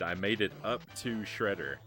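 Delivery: ordinary talk, nothing marked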